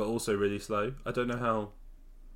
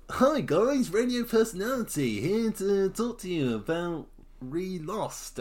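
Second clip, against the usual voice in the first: over-the-top presenter swoop, pitched way up; proper radio host; 8 semitones higher than usual. Acted. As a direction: put on a big showy radio-presenter voice. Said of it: "I'm going proper, uh".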